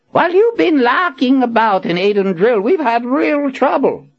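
put-on high, fussy old wizard scolding. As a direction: wag a finger, indignant old man. Zed scolding with wagging finger.

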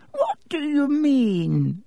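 very high, slow startled squeak; squeaky old headmaster. Acted. Dippet startled by Riddle's hint, squeaking high. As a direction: let the voice crack up high in alarm. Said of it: "with a squeak in his voice".

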